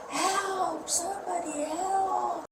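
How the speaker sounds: high, soft, long drawn-out call; imitated cry for help. Mother retelling the call to police, high muted mock cry. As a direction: call out thinly, stretching each word, without volume.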